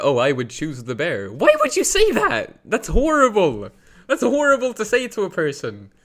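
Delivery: high, loud indignant cry, hammed up; mock outrage. Arguing with bear choosers, shrill loud mock outrage. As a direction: act scandalized and wail it.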